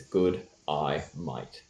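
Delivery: "Good eye, mate" in spaced-out words, falling away; sounded-out phrase. Shown as a demonstration. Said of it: "yeah, the phrase is".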